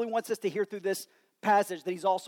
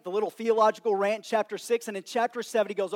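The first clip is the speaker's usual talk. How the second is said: ordinary talk, nothing marked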